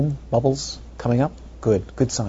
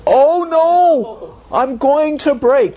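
high, slow squeaky panic; panicky bubble; 6 dB louder, much slower than usual. Pretend. Teacher voicing a bubble for the class, high squeak. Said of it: "soap bubble touches Jamie's nose".

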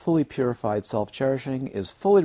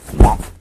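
a big hammer-blow whomp, shouted out; loud whomp; 17 dB louder than usual. Retold or imitated. Teacher hitting a student with the hammer, loud whomp. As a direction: slam it down with a booming whomp. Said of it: "and go".

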